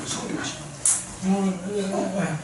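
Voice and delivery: very soft, very slow longing; drawn-out sigh. Acted. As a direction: let it melt out slowly and softly. (아주 느리고 작게 간절하게)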